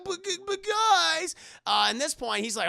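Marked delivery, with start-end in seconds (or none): 0.0-1.3 high, drawn-out comic protest; mock desperate whimpering plea; pleading whine. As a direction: beg the friends dropping you, pitched way up. Shunned boss pleads with members.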